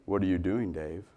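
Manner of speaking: calm computer speaking to Dave; low quiet robotic tone; HAL computer voice. Retold or imitated. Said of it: "kept saying".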